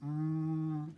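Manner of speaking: "Mm" held as one long, level hum; sounding out. Shown as a demonstration. Teacher sounding out maid, first sound held long and level. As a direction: stretch "Mm" evenly as a lone sound. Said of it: "Sound it out".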